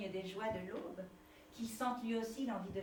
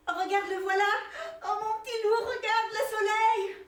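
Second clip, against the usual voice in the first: high, loud, laughing through tears; tearful joy; 10 semitones higher, 7 dB louder than usual. Acted. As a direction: overcome with joy at the sunrise. Mother moved by sunrise.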